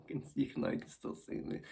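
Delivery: ordinary delivery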